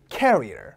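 "carrier" as KAIR-ee-er, KAIR punched; carrier, front stress. Shown as a demonstration. Teacher having the class repeat, "carrier" stressed at the front. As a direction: stress the first syllable of "carrier", falling low after. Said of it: "얘를 얘처럼 읽는 경우가 많죠".

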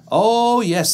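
high, loud delighted bees; eager bees voice. Acted. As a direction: gush like happy bees. Bees agree.

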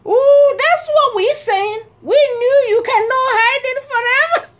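high, loud gleeful jeer; mocking children. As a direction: imitate kids crowing at catching someone out. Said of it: "these children mocked me".

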